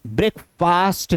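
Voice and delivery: ब्रेकफास्ट with a long "faast"; wrong pronunciation. Shown as a demonstration. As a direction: stretch the second half of ब्रेकफास्ट slowly, brek-faast. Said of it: "नहीं".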